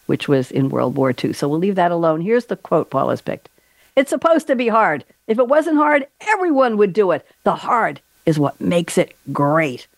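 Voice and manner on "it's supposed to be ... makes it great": emphatic movie speech, starting high and slowing down; Tom Hanks impression. Host performing guest's baseball film quote, emphatic coach impression. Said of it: "How was my Tom Hanks?".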